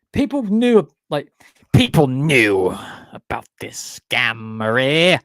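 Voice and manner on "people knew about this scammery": slow, loud put-on voice; hammed-up exposé. Joking that the voices were a scam, hammed-up. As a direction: draw it out theatrically, as mock scandal.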